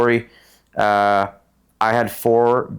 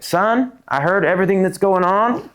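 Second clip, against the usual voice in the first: higher voice of a fatherly mentor, retold; imitating Jim Click; 8 semitones higher, slower than usual. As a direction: counsel him like a son, pitched up. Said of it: "And he's like".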